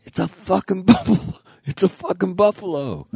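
high, loud yell with a laugh breaking in; yelled alarm. Retold or imitated. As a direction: shout the warning across the camp. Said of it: "somebody yells".

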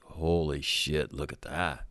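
low, slow, awed hush; hushed awe. Retold or imitated. Recruits gazing out the window at the captain, hushed and awed. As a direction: breathe it out in slow wonder. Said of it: "we're looking out the window going".

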